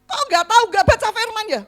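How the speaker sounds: strict leader scolding her pupil. Retold or imitated. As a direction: tell her off sharply. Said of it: "Langsung dimarahin".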